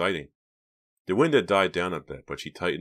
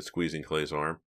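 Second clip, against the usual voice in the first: usual speaking voice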